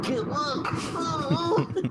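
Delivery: high-pitched playful panic, half laughing; mock terror. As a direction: squeal it like you can't watch. Covering eyes at scary movie.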